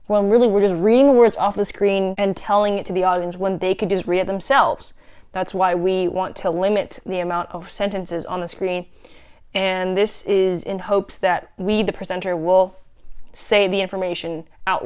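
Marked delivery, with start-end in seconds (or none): none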